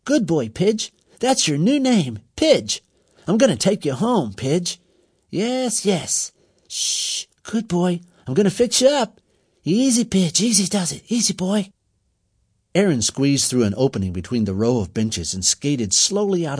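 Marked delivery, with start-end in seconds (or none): 0.0-6.3 soothing. A narrator's reading. Boy calming the wounded pigeon. 6.7-7.3 shushing. A narrator's reading. Hushing the pigeon. 7.4-11.7 soothing. A narrator's reading. Boy calming the wounded pigeon.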